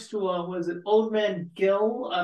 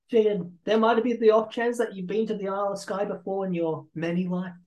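ordinary delivery

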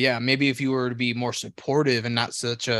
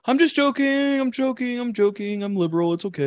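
mock backpedaling; 7 semitones higher than usual. Co-host insisting it was only a joke, mock plea. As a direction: hastily take it back in a high put-on voice.

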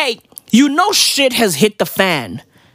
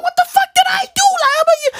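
very high, baffled outcry; much faster than usual. Retold or imitated. Comic imagining himself shot, high bewildered protest. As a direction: squeal it in mock bewilderment. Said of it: "I'm that fucking [name] meme".

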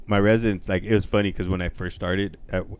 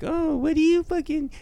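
much higher mocking sing-song; mocking whine; 4 dB louder than usual. Mocking people who say he sits in air conditioning, high whiny parody. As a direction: parody people who think his job is easy. Mocking what everybody says.

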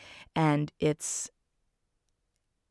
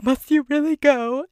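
put-on much higher, louder old-man voice; Uncle Albert pleading; 10 semitones higher, 6 dB louder than usual. Uncle Albert begging Mary Poppins to stay, high and pleading. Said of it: "he's got a cute lisp".

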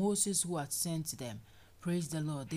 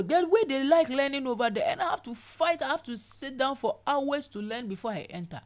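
indignant complaint; 3 semitones higher, 6 dB louder than usual. Acted. Voicing complaining believer.

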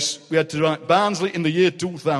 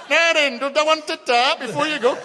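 cheeky Uncle Jack; 9 semitones higher than usual. Retold or imitated. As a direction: tempt them like a grinning prankster. Uncle Jack offers again.

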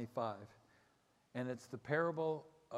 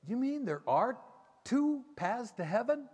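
surprised; 10 semitones higher, 7 dB louder than usual. Retold or imitated. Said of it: "And Lana's like".

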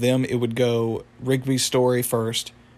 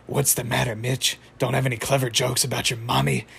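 normal talking voice

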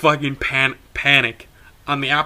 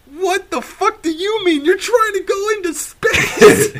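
mock incredulity; 5 dB louder than usual. Rider answering uncle stuck up the tree, exaggerated incredulous yell. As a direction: imitate yelling back in comic disbelief.

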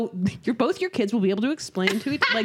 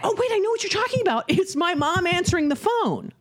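high-pitched sudden recognition; excited realization; 7 semitones higher than usual. Retold or imitated. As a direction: quote yourself blurting out a realization, much higher. Excited realization, quoting own reaction.

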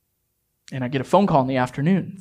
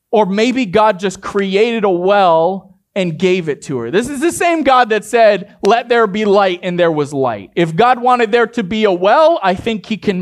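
louder, rising preacher's push; preaching loudly; 6 semitones higher, 5 dB louder than usual. Real, not acted. Pastor to congregation, loud emphatic preaching. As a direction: drive the point home to the congregation.

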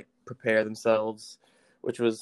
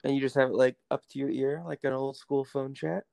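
over the phone; 2 semitones higher than usual. Real, not acted. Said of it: "put you on speakerphone".

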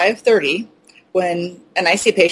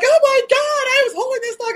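much higher, horrified squeal; panicked shriek. Retold or imitated. Wealthy client imitated shrieking at fleas, much higher. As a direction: recoil in disgust at the flea. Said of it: "She's like".

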